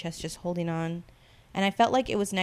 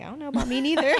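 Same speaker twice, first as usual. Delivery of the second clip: high joking; 7 semitones higher, 3 dB louder, much faster than usual. Retold or imitated. Joking self-doubt.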